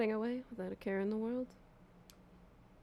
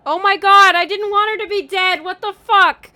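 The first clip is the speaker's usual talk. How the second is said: much higher, much louder disbelieving shriek; shocked outcry; 12 semitones higher, 17 dB louder than usual. Real, not acted. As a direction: scream it out in horror at her death. Reacting to Yoko's death.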